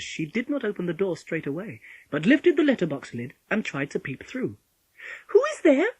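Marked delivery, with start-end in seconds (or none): 5.3-5.9 put-on high, loud little girl's call. A narrator's reading. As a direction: call through the door, pitched way up. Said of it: "she called out".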